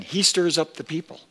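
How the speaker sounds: urgent accusation. Acted. Preacher voicing the accusers before Pilate, quick and high. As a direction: snap it out like an angry accuser. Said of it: "They were urgent".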